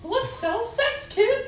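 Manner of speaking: squeaky character voice. Acted. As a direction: drag it out in a squeaky cartoon voice. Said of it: "That's his character voice".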